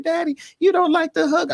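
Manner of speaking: father voicing his small daughter's complaint; imitating daughter. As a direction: play his young daughter asking. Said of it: "She said".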